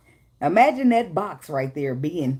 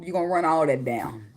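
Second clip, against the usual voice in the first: usual speaking voice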